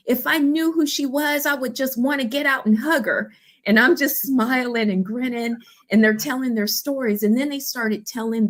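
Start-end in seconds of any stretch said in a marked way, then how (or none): 0.1-3.3 lightly acted yearning; longing gush. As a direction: voice a passenger dreaming of meeting her. Ladies wishing to meet Tashana.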